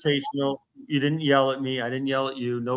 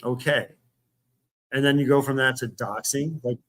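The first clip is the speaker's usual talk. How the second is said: normal talking voice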